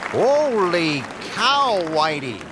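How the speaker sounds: put-on slow, drawn-out, high old-school announcer call; Phil Rizzuto impression. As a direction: stretch out Holy Cow like a vintage baseball broadcaster. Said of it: "in the words of the late, great baseball commentator for the Yankees, Phil Rizzuto".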